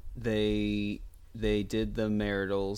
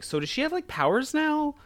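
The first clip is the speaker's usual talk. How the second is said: retold question. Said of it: "I was like".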